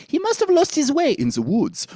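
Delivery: high-pitched French-accented line. Shown as a demonstration. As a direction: play the French accent at the top of your range. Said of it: "It can be up".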